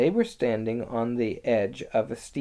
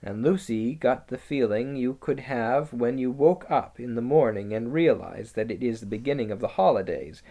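ordinary delivery, nothing marked

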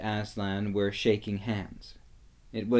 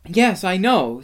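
put-on higher, louder, impatient girl; cross; 8 semitones higher, 7 dB louder than usual. A narrator's reading. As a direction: snap it back, irritated. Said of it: "said Lucy crossly".

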